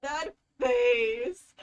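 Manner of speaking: loud delighted cry at the screen; excited outburst. Real, not acted. Streamer reacts to the character's smug face, loud and thrilled.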